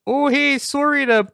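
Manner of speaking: player's character calling to the closed door; yelled call. Acted. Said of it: "I'm gonna yell".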